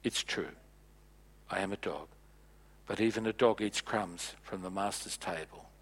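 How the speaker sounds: soft, low, humble answer; the Gentile woman answering Jesus softly; humble quiet reply. Retold or imitated. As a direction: say it low and meek, after a pause. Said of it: "she pauses and she says".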